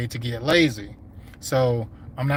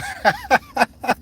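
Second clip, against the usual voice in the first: high burst of laughter; 12 semitones higher, 3 dB louder than usual. Real, not acted. Laughing at own joke.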